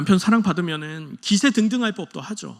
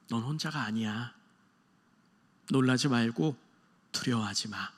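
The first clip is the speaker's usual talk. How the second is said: gentle comfort; 6 semitones lower, 5 dB quieter than usual. Retold or imitated. God comforts Hanna.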